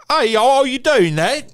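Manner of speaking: loud, very high English chirp; Mary Poppins-style British accent. Shown as a demonstration. Host demonstrating a thicker English accent, loud high British voice. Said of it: "like, Mary Poppins kinda".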